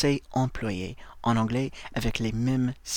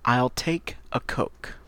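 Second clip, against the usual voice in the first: slow, clear "I'll take a Coke"; slow model phrase; slower than usual. Shown as a demonstration. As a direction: model it slowly. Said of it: "Par exemple".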